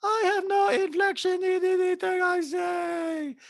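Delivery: very high, slow, inflectionless parody; mocking robotic send-up of a housewife; soulless monotone. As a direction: hold every word on one high note. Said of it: "she says it like a soulless person".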